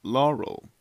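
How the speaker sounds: flat, clipped machine Laurel; computer voice imitation. Shown as a demonstration. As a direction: do the recording's voice. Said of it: "Here we go again".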